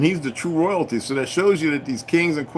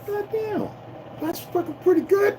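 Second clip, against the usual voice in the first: much higher, half-sung "God damn" of grudging praise; mock admiring exclaim; 4 dB quieter than usual. Sitting back admiring the enemy's plan, in a much higher mock voice. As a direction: pitch it way up like a cartoon of himself impressed. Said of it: "sit back and go".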